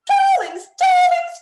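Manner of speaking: very high, loud, camp frog cry; Fabiano the Frog. Acted. Host performs the frog's weekly greeting to children, high and camp. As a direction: gush like a fabulous flamboyant frog. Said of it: "what he says to everybody every week is".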